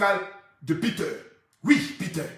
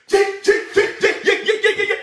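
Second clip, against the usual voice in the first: loud, higher repeated tchi; high tchi sounds; 7 semitones higher, 4 dB louder, faster than usual. Acted. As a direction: fire off tchi tchi tchi sharply. Onomatopée aiguë. (onomatopée tchi aiguë et forte)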